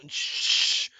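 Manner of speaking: sharp mock "shh"; loud shush. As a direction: shush a noisy gamer. Acts being shushed in library.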